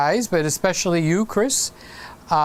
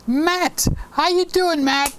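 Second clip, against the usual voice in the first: excited greeting; 11 semitones higher than usual. Real, not acted. Excited greeting to a chat viewer.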